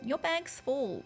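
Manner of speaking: high mock game alert; mock notification voice. Game message quoted in high voice.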